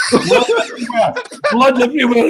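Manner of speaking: loud, high and laughing; a teller laughing while retelling the cop's gory words. Retold or imitated. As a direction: laugh through the gory quote. Cop describing the homicide.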